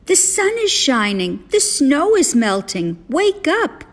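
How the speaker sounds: high, eager wake-up call; crying out. A narrator's reading. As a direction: coax a sleeper up with good news. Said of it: "cried Frog".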